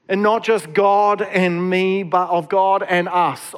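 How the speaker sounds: ordinary talk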